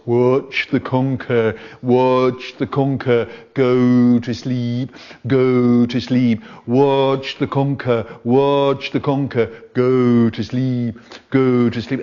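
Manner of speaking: slow, droning spooky chant; spooky hypnotist. Acted. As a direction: lull him to sleep with a spooky drone. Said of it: "talking in this spooky voice".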